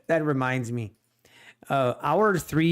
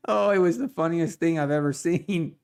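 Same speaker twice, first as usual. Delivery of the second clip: normal talking voice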